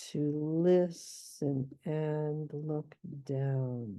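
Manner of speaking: half speed, every beat drawn out; very slow scansion. Shown as a demonstration. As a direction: stretch "To listen and look down". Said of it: "that's definitely an anapest".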